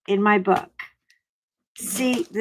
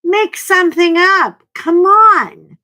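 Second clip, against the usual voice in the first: high, loud impatient command; wife loudly urging her shy husband to just talk; exasperated yell; 10 semitones higher, 11 dB louder than usual. Retold or imitated. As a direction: shout it at a tongue-tied husband. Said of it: "I'm like".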